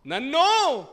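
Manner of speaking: high, loud pleading shout; crying out. Acted. Blind man calling Jesus for mercy, loud cry. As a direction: throw the word out as a cry. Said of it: "కేకలు పెడుతున్నాడండి".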